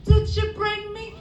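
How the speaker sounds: put-on high, slow singsong kid voice; sing-songy little girl. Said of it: "She's a little sing-songy".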